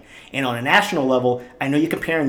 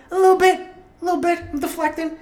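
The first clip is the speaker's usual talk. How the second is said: much higher, silly put-on voice; squeaky mock voice; 13 semitones higher, faster than usual. Host joking his way out of the topic, squeaky voice. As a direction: squeak it out, playfully dodging. Mocks himself after admitting anger.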